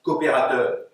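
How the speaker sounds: emphatic declamation of verse; poetry reading. Acted. Poet reading a political passage to an audience, emphatic declamation. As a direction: declaim it firmly, word by word. Poets read their texts aloud.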